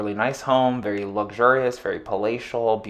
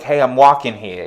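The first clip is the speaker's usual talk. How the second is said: New Yorker; 3 semitones higher, 7 dB louder than usual. Retold or imitated. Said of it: "it's very New Yorker".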